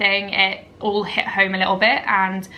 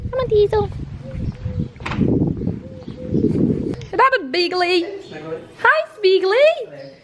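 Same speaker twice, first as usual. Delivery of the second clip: high pet-talk voice; 12 semitones higher than usual. Real, not acted. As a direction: coo at the puppies in a sing-song. Cooing to her dogs.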